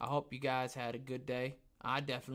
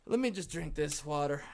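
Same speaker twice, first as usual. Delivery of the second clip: usual speaking voice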